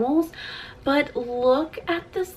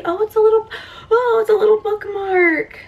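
delighted surprise; 10 semitones higher, 4 dB louder, faster than usual. Real, not acted. Discovering bookmark.

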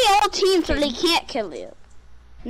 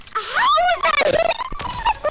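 raging gamer; 11 semitones higher, much slower than usual. Real, not acted.

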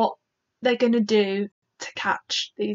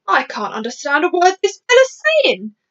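higher, irritated army chief; raised, testy officer's voice; snapping; 6 semitones higher, 4 dB louder than usual. Acted. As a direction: complain with mild exasperation. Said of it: "the head of the army snapped".

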